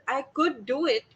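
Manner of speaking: ordinary delivery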